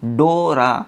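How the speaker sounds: डोरा with a long, held first o; stretched with force. Shown as a demonstration. Teacher showing a practice word, first vowel stretched. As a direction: lean into the o of डोरा and draw it out. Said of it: "थोड़ा सा ताकत लगा लेंगे".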